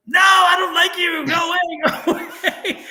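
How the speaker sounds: high, loud retold shout; frightened child's refusal. Girl to her dead grandmother's spirit, high frightened yell. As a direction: cry out in mock fright, stretching the words.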